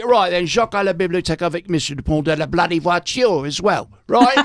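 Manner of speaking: put-on lightly done London accent, dropped R's; a hint of a London schoolmaster teaching French; West End teacher voice. London French teacher addressing the class, lightly voiced accent. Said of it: "and he would say".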